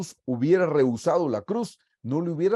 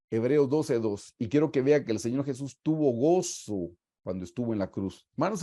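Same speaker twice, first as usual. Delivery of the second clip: ordinary delivery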